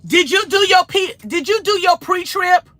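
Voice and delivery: high, loud mocking repeat of the question; mocking troll question. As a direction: parrot the troll in a shrill-pitched needling voice. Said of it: "Just like that".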